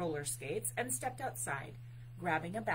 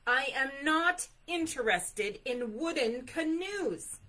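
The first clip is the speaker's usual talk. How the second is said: high, loud, indignant bear; even louder; 6 semitones higher, 10 dB louder than usual. A narrator's reading. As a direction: refuse it at full volume. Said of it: "Bear's voice was even louder".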